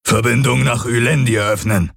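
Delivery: lightly voiced alien, ringing command; calling out. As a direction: call it so it echoes. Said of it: "Sein Echo gellte durch die Halle".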